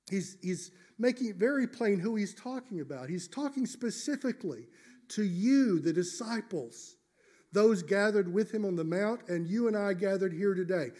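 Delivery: usual speaking voice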